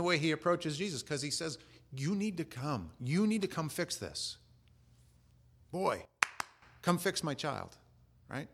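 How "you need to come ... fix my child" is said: commanding. Acted. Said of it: "'Cause you call for a servant".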